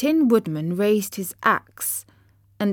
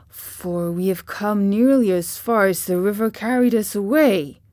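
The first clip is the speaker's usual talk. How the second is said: Scarecrow voice; 3 dB louder than usual. Acted. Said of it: "remarked the Scarecrow".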